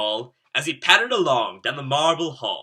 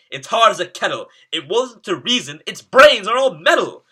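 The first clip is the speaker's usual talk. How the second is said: exasperated knight; 7 semitones higher, 9 dB louder than usual. A narrator's reading. Frustrated knight thinking aloud.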